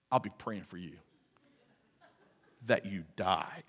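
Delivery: a joking curse said under the breath; mock sinister aside. Preacher teasing Cody with a muttered joking curse, mock sinister. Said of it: "No, I'm kidding".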